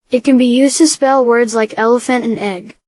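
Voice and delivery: ordinary delivery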